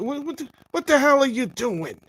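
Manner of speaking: hammed-up fatherly outburst; a hint of a furious father telling off his kid; imitating an angry father. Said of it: "the same way your father would call you out and yell at you".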